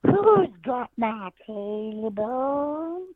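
high, slow, drawn-out ghostly wail; haunting voice. Acted. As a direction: moan it like a ghost in the woods. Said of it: "and he goes".

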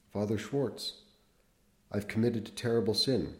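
usual speaking voice

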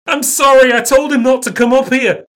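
high, loud panicked scream. Acted. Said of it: "Greg screams".

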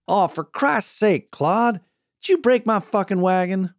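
high, exasperated Western boss woman; loudly fed up; exasperated scolding. A narrator's reading. Wagon boss berating her teamster.

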